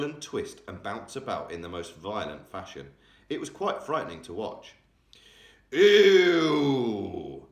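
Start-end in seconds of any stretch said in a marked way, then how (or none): 5.7-7.5 loud, high, strained roar of a giant; a monster howling in its sleep; giant's roar. A narrator's reading. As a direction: roar it out in pain. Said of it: "roared the flesh lump eater".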